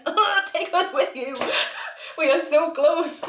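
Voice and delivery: high-pitched, drawn-out plea through laughter; mock pleading. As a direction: beg playfully to be taken along.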